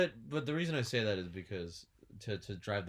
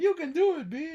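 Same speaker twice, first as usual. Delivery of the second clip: high, loud cheering shout; excited cheer; 10 dB louder than usual. Retold or imitated. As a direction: cheer someone on at full volume. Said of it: "he's like".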